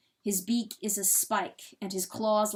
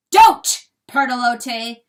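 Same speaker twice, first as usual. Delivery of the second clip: much louder, harsh rebuff; sharp rebuff; 3 semitones higher, 16 dB louder, slower than usual. A narrator's reading. Rooster rejecting hen's love, harsh loud rebuff. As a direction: cut her off hard.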